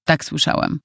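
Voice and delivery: plain reading voice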